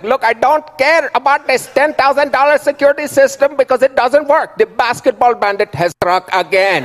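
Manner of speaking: high-pitched, hammed-up Indian-accented rant; Indian manager yelling. Manager on phone about useless security system, high Indian-accented rant. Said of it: "he's yelling at somebody".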